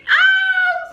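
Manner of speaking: very high, loud "oh"; reenacted gleeful outburst; excited whoop. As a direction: squeal in delighted surprise. Acting out her excited reaction.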